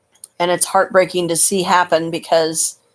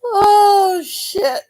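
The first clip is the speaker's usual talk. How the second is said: laughing outburst; 12 semitones higher than usual. Real, not acted. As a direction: burst into laughter. Bursting out laughing.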